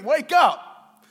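loud shouted wake-up call; screaming disciples. Retold or imitated. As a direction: scream it at a sleeping friend. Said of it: "they're all screaming".

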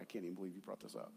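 very low, hushed, rapid muttering; disapproving wife. Retold or imitated. Wife embarrassed by the burrito complaint, muttered low and fast. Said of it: "she's shaking her head. She's like".

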